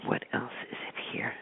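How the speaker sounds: muttered whisper while searching notes; whispering to herself. Real, not acted. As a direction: whisper it under your breath. Searching her notes aloud.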